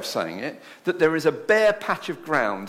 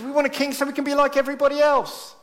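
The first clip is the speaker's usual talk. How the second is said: much higher, louder petulant demand; whiny crowd; 13 semitones higher, 4 dB louder than usual. Retold or imitated. The people answering Samuel, a mock-petulant demand. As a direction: plead like a spoilt crowd.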